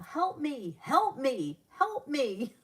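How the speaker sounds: mock yell for help, pitched high; imitated child's yell. Aunt imitating her nephew on all fours, high mock yell. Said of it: "he would yell".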